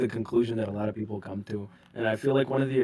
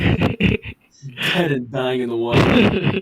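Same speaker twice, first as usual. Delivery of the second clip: slow dramatic; 9 dB louder, much slower than usual. Pretend. Dramatic verdict on fandom.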